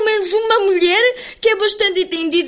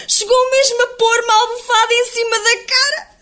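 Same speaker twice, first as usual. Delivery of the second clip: high, whiny mock-crying to mum; hammed-up tearful complaint; 6 semitones higher than usual. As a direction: wail it like a wronged newlywed, hammed up. Filha queixosa chora à mãe. (lamento choroso, exagerado)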